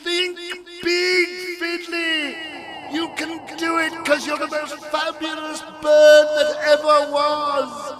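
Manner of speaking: high, loud rallying cry; shouted encouragement. A narrator's reading. Algernon urges Fiddly to think big, shouting encouragement. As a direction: cheer someone on at full voice, drawn out. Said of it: "shout along with me".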